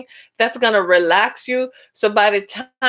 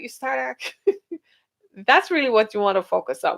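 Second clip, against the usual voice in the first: normal talking voice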